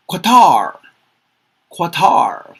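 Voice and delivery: teacher modelling "Qatar" loudly; careful pronunciation. Shown as a demonstration. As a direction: announce "Qatar" as kwa-TAR boldly. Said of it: "That's how I pronounce it".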